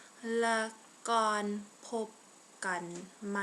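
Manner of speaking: slow, each word on its own. Shown as a demonstration. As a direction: pause after every word so it can be heard. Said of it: "say it a little bit more slowly".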